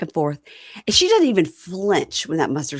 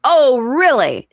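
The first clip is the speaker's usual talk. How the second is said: drawn-out mock disbelief; 6 semitones higher, 7 dB louder, much slower than usual. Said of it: "And she goes".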